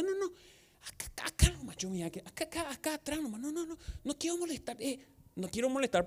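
hushed and shy, mock whisper pleading to stay at the back; mock timid whisper. Churchgoer refusing the usher's front seat, timid mock whisper. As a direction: shrink away, whispering, not wanting to bother anyone.

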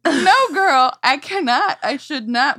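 hammed-up busted gasp; mother caught out by her daughter, mock alarm.

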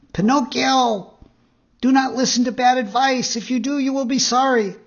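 very high, loud blackbird warning; sharp, clear bird call. A narrator's reading. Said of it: "calling out in a sharp, clear voice".

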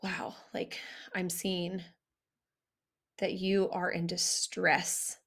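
soft, low, gentle acknowledgement; supportive demonstration. Shown as a demonstration. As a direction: say it slowly and tenderly, showing you see their pain. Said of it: "I'm gonna show you".

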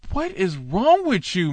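high, odd put-on voice of disbelief; incredulous outburst. Preacher reacting to the teacher's menorah claim, incredulous high voice. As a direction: ask it as if the claim were absurd.

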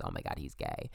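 low hushed gossiping classmate; mock gossip voice. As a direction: drop way down and hush it like a nosy kid. Imitating classmates' reaction to him.

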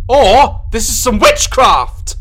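high, loud, slow hammy declaration; dramatic exclamation. Acted. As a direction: ham it up theatrically.

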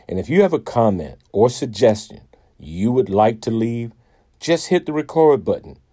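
ordinary talk

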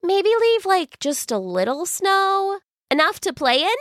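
put-on hopeful girl voice. Silva asks sweetly.

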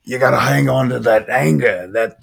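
lightly performed film villain monologue; a touch of movie-quote drama. Acted. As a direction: draw out the key words with mild theatrical intensity. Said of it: "If you've never seen The Devil's Advocate".

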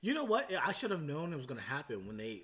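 ordinary delivery

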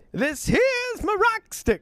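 put-on very high, slow old miner; old prospector. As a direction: drawl it like a stubborn old miner. Old prospector answering staff.

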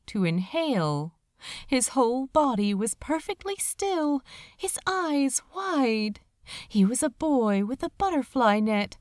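usual speaking voice

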